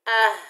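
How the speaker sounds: आह said loud and heavy from the throat; a loud, full-throated आह; heavy sound. Shown as a demonstration. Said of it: "भारी sound से अदा करेंगे".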